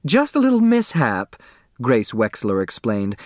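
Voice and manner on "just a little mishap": hostess voice. Acted. Hostess smoothing over explosion.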